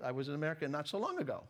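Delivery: normal talking voice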